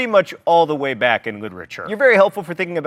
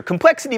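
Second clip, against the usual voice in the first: "Complexity" repeated high with a rising tune; emphatic echo; 8 semitones higher, 3 dB louder than usual. Shown as a demonstration. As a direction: lift "Complexity" up as a pointed aside. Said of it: "by the way, not seen in".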